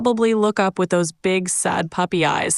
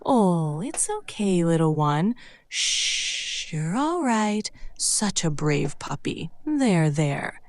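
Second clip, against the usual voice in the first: soft, slow, calming coo; gentle soothing voice; 6 dB quieter, slower than usual. Shown as a demonstration. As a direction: comfort a hurt puppy softly. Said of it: "use a gentle, soothing voice".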